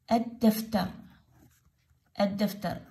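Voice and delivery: الدفتر with a doubled d and no L; silent lam. Shown as a demonstration. Teacher repeats the word for pupils, lam silent. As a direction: say الدفتر as "ad-daftar". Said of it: "لا، ما تلفظ اللام".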